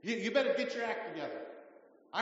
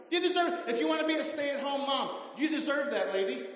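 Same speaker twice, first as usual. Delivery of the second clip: high and loud, rising to a rallying cry; rousing preaching; 7 semitones higher, 3 dB louder than usual. Real, not acted. Pastor rallying women in church, rousing preaching. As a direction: lift the room with each line.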